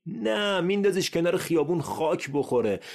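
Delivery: high, loud mocking answer; sarcastic jab. Mocking the listener who neglects the car, high and loud. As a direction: snap back a scornful no.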